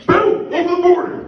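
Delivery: high, loud burst of triumph; excited exclamation. Retold or imitated. Traveler crossing the border, triumphant high exclamation. Story jumps across the border.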